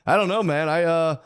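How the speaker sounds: mock the indecisive guy; dithering customer. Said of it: "The next guy's like".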